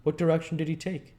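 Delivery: put-on high, loud, urgent young man; Harry's urgent question. A narrator's reading. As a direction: demand it in a hurry. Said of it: "he asked".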